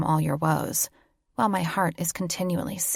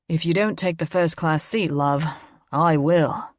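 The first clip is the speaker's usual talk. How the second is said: a hint of a pushy British bloke, louder; rude seatmate; 5 dB louder than usual. Seatmate butting in to grab the first class seat, pushy and loud. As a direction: lean in and claim the seat. Salami-breathed neighbour butting in.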